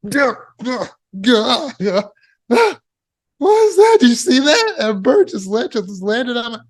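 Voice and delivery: high-pitched alarmed yelps, breaking into panicked questions; startled freak-out. Shown as a demonstration. Speaker showing how you'd react to a bird landing, startled freak-out. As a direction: act out a hammed-up fright, voice shooting up. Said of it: "Maybe something like this".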